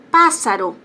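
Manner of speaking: "Pássaro" com PÁ alto e forte; tônica em PÁ. Shown as a demonstration. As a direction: bata na primeira sílaba de "Pássaro". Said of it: "o pá é mais forte". ("Pássaro" proparoxítona, PÁ destacado)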